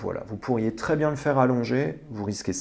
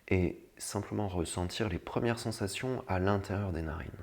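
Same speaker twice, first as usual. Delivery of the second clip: guided meditation; 4 semitones lower, 8 dB quieter than usual. Acted. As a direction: lead them gently into calm. (voix douce de méditation guidée)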